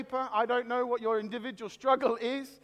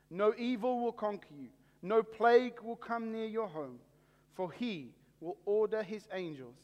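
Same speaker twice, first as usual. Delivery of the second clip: scripture reading; 3 semitones lower, 4 dB quieter than usual. Acted. Pastor reading scripture to online viewers, measured and reverent. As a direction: read the psalm reverently to the congregation. Reads a psalm aloud.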